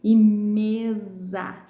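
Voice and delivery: mesa slowly, with a buzzing Z for the S. Shown as a demonstration. Teacher showing pupils the Z sound, S as Z in "mesa". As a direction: draw out "mesa", voicing S as Z. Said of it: "o S fica com som de Z".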